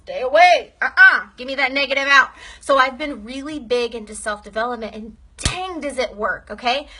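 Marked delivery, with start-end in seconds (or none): 0.0-2.3 louder, sassy push-away; mock fending off. Pushing away a negative person's mood, loud sassy refusal. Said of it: "I gotta, like".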